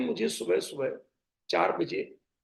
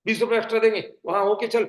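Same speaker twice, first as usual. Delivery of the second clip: bossy order; 3 semitones higher, 7 dB louder, faster than usual. Retold or imitated. Bossy passenger ordering driver.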